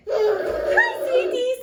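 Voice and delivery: high, loud, happy greeting. A narrator's reading. Happy dwarf greets the sleeping visitor, happy and loud. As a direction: chirp it loudly like a happy dwarf. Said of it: "says the happy dwarf".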